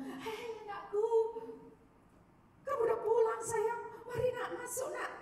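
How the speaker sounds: mother's high doting welcome; loving mother. Acted. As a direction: coo over a child who came home. Mother voiced welcoming son home.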